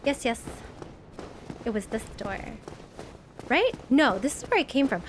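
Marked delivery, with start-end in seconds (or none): none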